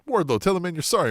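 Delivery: high, commanding boss voice; MJF ordering Wardlow. Retold or imitated. MJF telling Wardlow to apologize to Jericho, bossy. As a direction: order your bodyguard around, sweetly. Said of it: "And then he's like".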